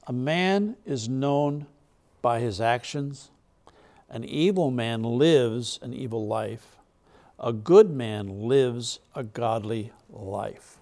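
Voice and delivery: unhurried, solemn reading of the verse; slow scripture reading. Acted. Preacher reading Proverbs aloud to his congregation, slow and solemn. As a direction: read it out slowly, weighing every phrase.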